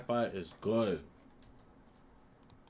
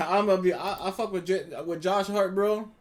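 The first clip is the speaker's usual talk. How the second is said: excited laughing; 7 semitones higher, 8 dB louder than usual. Real, not acted. Laughing, hyped about Josh Hart.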